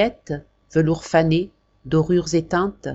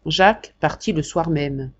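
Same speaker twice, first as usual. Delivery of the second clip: ordinary delivery, nothing marked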